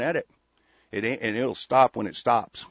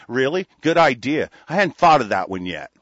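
loud, sarcastic good idea; sarcastic mock agreement; 2 semitones higher, 9 dB louder than usual. Host mocking imagined agreement, loud and sarcastic. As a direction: boom out mock approval.

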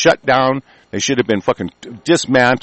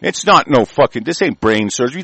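normal talking voice

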